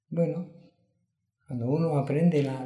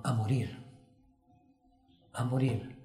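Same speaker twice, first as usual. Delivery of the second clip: ordinary talk, nothing marked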